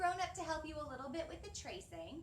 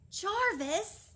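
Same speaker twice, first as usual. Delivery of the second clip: joyful call; 8 semitones higher, 11 dB louder than usual. Acted. Edgar calling out joyfully.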